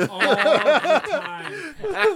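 high, slow, laughing put-on lisp; laughing mock voice. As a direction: stretch it out while cracking up.

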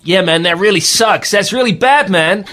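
hammed-up American dude accent; pretend American accent. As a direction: play a brash American gamer. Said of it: "I pretend to be an American".

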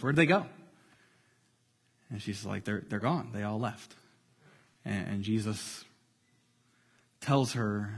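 soft, low storytelling voice; hushed story narration. Acted. Preacher retelling the woman's rescue to the church, hushed. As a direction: draw the listeners in, barely above a murmur. Tells the scene in a hush.

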